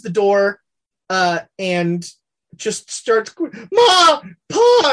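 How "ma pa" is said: put-on very high, loud wailing child; crying child. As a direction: wail for your parents like a scared kid. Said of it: "and he starts crying".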